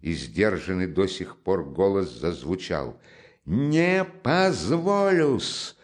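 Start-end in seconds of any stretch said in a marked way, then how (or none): 3.4-5.8 slow, high, loud shout; sudden shout. Acted. Raskolnikov shouts at Porfiry, furious and loud. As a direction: bang the table with it. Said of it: "крикнул он вдруг".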